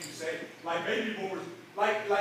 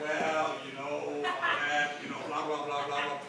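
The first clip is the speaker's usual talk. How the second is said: dull regular people; 6 semitones lower, 3 dB quieter than usual. Retold or imitated. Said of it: "they're like".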